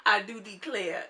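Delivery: hammed-up Southern country accent. Showing off to viewers, playful country accent. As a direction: put on a country accent, amused. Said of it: "thumbs up for my country accent".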